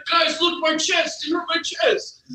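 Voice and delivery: high, whiny "it hurt my chest"; whiny mock complaint. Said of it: "He's like".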